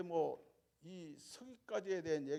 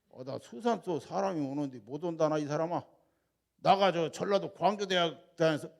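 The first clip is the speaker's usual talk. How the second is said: Jeolla dialect; 8 dB louder than usual. Retold or imitated. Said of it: "전라도 말에 솔찬히 잘합니다".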